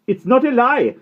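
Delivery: high, loud desperate shout; sudden shout. A narrator's reading. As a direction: burst out at the headmaster. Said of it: "Patrick shouted suddenly".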